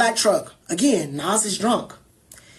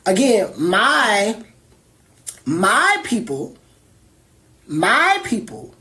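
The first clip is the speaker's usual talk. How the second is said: slow emphasis; much slower than usual. Real, not acted.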